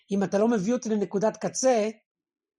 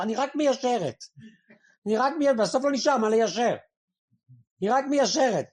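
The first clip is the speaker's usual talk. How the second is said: mock innocent wife, pitched higher; imitating the wife; 4 semitones higher than usual. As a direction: play the wife, innocently excusing herself. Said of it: "מכירים את זה שאנשות, נשים". (חיקוי של האישה, בקול גבוה ותמים)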